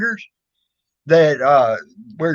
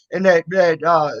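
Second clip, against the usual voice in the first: ordinary talk, nothing marked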